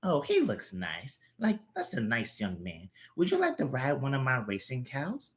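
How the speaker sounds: a gentle grandma, quiet; sweet grandma voice. Acted. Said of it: "girl voices".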